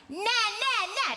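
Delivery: high, loud bratty na-na; whiny two-year-old's taunt; bratty toddler sound. Shown as a demonstration. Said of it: "really good at making that sound".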